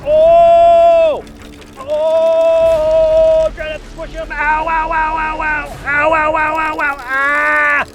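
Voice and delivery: high, loud yelps of real pain; ow after ow, stung and hurting; crying out in pain. Real, not acted. Caterpillars stinging his arm.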